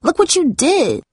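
high-pitched tearful wail; crying accusation. A narrator's reading.

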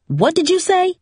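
high, rising challenge from a mother; sharp questioning. A narrator's reading. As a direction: demand a repeat, pitch leaping up. Mother turns quickly on mumbling daughter.

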